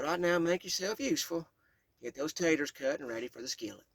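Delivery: put-on bossy but kind, Southern Kentucky accent; Southern Kentucky great-grandmother. Great-grandmother giving the child orders, Southern Kentucky accent. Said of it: "A deep Southern Kentucky accent emphasized her words".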